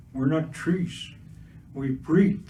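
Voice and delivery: ordinary delivery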